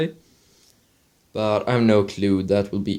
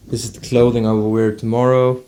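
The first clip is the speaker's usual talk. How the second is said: ordinary talk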